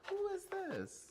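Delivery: very high startled question; surprised high squeak. Real, not acted. Startled at weird voice.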